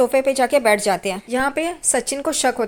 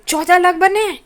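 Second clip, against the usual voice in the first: higher and louder, aghast; shocked outcry; 6 semitones higher, 5 dB louder than usual. Retold or imitated. Family hearing the fourteen lakh fee, shocked and louder. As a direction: blurt it out in disbelief. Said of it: "सब लोग चौंक जाते हैं".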